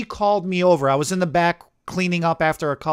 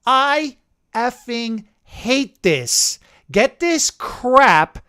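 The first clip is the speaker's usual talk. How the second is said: slow, drawn-out furious rant; imitating a furious client; mock angry client; 4 semitones higher, 3 dB louder, much slower than usual. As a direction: spit out the hatred in an exaggerated voice. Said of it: "she's screaming".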